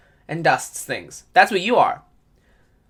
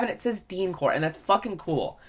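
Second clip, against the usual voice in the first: ordinary talk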